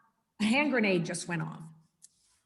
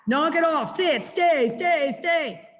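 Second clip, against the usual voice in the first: a hint of a frantic owner barking commands, pitched up; mock owner yelling; 6 semitones higher, slower than usual. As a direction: imitate a flustered owner shouting at the dog. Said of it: "you're not yelling and screaming".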